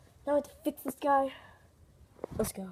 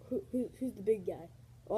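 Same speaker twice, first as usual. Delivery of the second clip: normal talking voice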